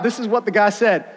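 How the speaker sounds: ordinary talk, nothing marked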